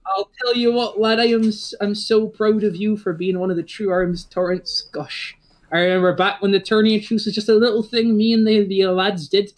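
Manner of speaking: a proud father beaming over his son; jolly old dad. Acted. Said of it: "ruffles his hair and goes".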